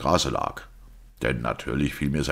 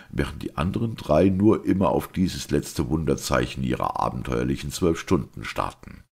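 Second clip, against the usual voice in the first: ordinary delivery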